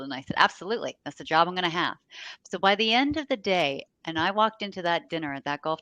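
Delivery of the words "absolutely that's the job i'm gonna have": bold, loud, certain answer; retelling her younger self's bold reply; confident declaration. Retold or imitated. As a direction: state it proudly, no doubt at all.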